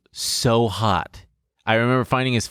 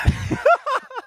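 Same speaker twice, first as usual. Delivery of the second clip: high laugh. Real, not acted.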